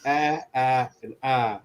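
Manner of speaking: Ah, ah, and ah, all one flat vowel; imitating the mother's flat vowels. Shown as a demonstration. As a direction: demo the three identical vowels. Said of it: "She has, like, three vowels".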